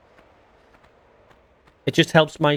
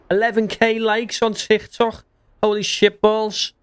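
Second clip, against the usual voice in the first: excited; 8 semitones higher, slower than usual. Real, not acted. Excited at TikTok likes.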